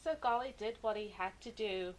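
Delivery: ordinary delivery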